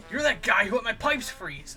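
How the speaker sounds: high, loud acted accusation; mock furious shout. Man standing over his victim, mock furious shout. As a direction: yell it like a hammed-up avenger.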